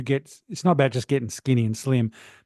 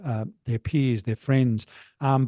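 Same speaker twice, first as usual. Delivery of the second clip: ordinary talk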